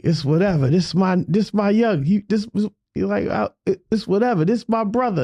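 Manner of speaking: high, excited hype-man voice; a hype man vouching for his young; imitating Malachi.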